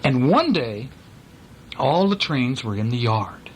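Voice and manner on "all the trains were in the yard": plain reading voice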